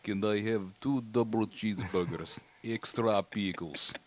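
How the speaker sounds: low, soft, slow put-on Russian accent; a Russian father ordering burgers in heavy accent; Russian father's accent. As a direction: play the Russian dad ordering at McDonald's, low and quiet. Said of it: "and this is him".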